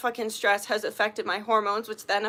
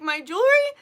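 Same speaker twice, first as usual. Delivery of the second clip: incredulous; 7 semitones higher than usual. Retold or imitated. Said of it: "I'm like".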